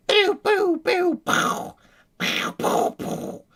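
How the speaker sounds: very high pew pew laser sounds; tank gun noises. Pretend. Streamer to chat, tanks firing, high pew pew noises. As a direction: shoot like a kid playing with toy tanks. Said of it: "they're like".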